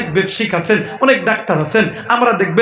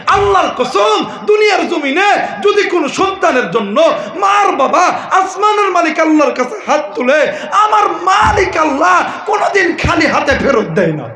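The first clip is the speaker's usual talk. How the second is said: preaching at full force; 8 semitones higher than usual. Acted. Preacher swears to the crowd on the Quran, full shout. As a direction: thunder the promise to the crowd. Sermon climax.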